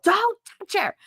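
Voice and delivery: high-pitched mock yell; imitated yell. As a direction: yelp it out like an urgent warning. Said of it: "and G yelled".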